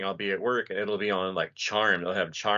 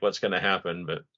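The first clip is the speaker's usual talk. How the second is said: usual speaking voice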